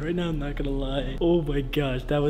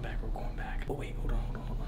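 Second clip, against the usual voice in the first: whispering; 5 semitones lower, 7 dB quieter than usual. Real, not acted. Sneaking back quietly.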